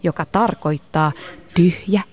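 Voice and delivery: clear, projected presenter read; scripted explainer narration. A narrator's reading. Host explains karate history to listeners, projected narration. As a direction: narrate it like a children's documentary.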